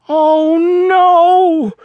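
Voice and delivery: high loud oh no; dismayed cry. Acted. Froggy realizing he forgot something, high dismayed cry. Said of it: "cried Froggy".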